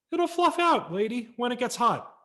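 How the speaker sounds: high, loud, put-on salesman voice; pushy lot salesman; tree salesman. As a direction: brush off the customer's worry. Tree seller answers mother.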